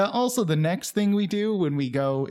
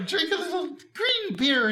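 leprechaun voice; 12 semitones higher, 5 dB quieter than usual. Pretend. Continuing leprechaun bit.

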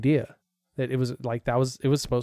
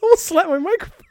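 laughing; 5 dB louder than usual. Real, not acted. Laughing at being scared.

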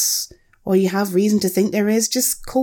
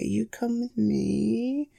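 slow, much softer, rising at the end; slow soft coaxing; 8 dB quieter, much slower than usual. Acted. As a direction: coax the little guy gently along. Coaxing the caught spider.

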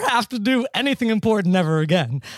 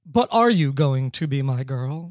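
slow, lower, pondering question; Seinfeld as philosopher; 6 semitones lower, slower than usual. Acted. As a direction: muse on it like a philosopher, drawn out. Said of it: "like, Seinfeld as philosopher".

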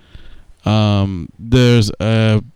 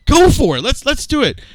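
much higher, eager push. Real, not acted. Urging friend to start the reading, high and eager. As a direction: cheer the reading on. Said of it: "dramatic reading".

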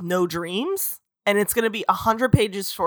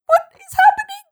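sudden very high squeak; a comic high squeak mid-sentence; mock Jacob squeak; 4 dB louder than usual. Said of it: "And he's like".

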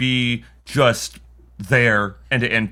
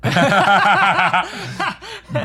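loud burst of laughter; 4 dB louder than usual. Real, not acted. Friend's joke "dick appointment.

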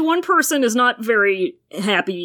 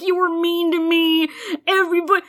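put-on whiny teen; 6 semitones higher, faster than usual. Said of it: "He's like".

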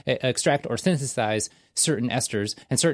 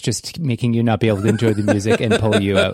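loud, urgent announcer over music; hold message announcer; 6 dB louder than usual. Pretend. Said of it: "This is a voice that makes you think".

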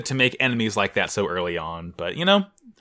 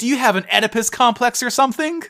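mock disgust; 12 semitones higher, 3 dB louder than usual.

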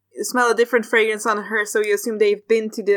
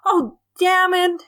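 high, drawn-out dismay; 10 semitones higher, slower than usual. Acted. Runners spot the team already there, dismayed. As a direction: groan it out in mock defeat. Arriving runners see they lost.

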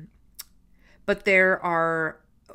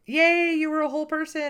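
mock-delighted cheer; 11 semitones higher, 3 dB louder than usual. Co-host cheering the actor's discovered past, playfully delighted.

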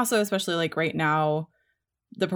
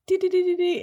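high piccolo toots; 12 semitones higher, 4 dB louder than usual. Retold or imitated. Imitating Wonka's piccolo.

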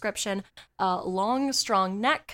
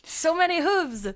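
hammed-up French accent exclamation; slow, exaggerated French cry of dismay; french chef; 6 semitones higher, 7 dB louder, slower than usual.

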